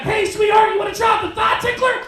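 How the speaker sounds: high, loud hammed-up come-on; over-the-top mock seduction yell; cheesy pickup line. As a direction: shout the line like a sleazy flirt. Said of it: "I can't be like".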